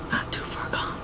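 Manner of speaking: hushed, breathy retelling; whispered quote. Retold or imitated. Villain's line retold in a whisper.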